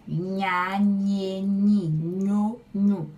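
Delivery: "Nha" "nhe" "nhi" "nho" with a palatal ñ, projected; eñe drill. Shown as a demonstration. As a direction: practise each ñ syllable clearly. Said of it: "Vamos treinar".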